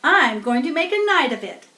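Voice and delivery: a rat off to party all night; gleeful rat. A narrator's reading. Rat gloats before the fair.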